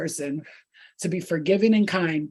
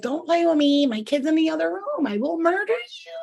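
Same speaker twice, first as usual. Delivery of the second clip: shrieked threat; 10 semitones higher than usual. Retold or imitated. A mother warning the man at her door, a high mock threat. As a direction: warn him off, pitch climbing.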